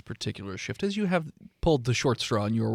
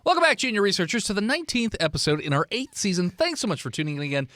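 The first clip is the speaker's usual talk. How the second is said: quick, higher host greeting; show host opening; 5 semitones higher than usual. Acted. Game master restarting the episode welcome, upbeat host. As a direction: brighter host welcome, fired off.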